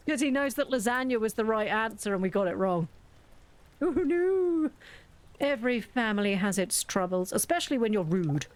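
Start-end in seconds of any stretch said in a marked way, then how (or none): 3.8-4.7 mock dismay. Character reacting.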